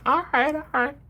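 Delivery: very high, far-off squeaky voice giving in; high mock reluctant husband. Husband retelling how he gave in to his wife, high squeaky voice. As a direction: play yourself caving in a squeak. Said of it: "And I'm like".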